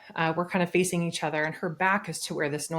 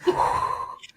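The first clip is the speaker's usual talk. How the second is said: long blown-out breath; acted steadying breath to slow a racing heart; calming exhale. Hunter calming herself before the shot, exhaling slowly. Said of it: "calm your breath".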